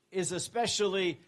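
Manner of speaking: ordinary talk, nothing marked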